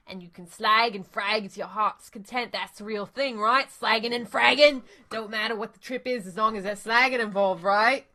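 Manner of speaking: a pushy street hustler, lightly voiced; street dealer. Dealer hyping slagging and fragging to runners, pushy and loud. Dealer character keeps selling.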